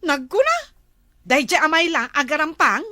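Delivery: loud, indignant railing; cross, forceful and loud; jealous scorn. Acted. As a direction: rail loudly about a rival. Wife rails about the seller.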